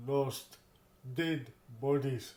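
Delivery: ordinary talk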